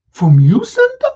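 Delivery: high rising disbelief; amazed. Acted. Ricky amazed, asking Santa about the gift. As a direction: squeal the name in wonder.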